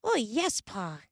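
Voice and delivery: high eager yes; eagerly. A narrator's reading. Carrie promising Pa to help win, high and eager. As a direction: chirp it out keenly. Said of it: "Carrie said eagerly".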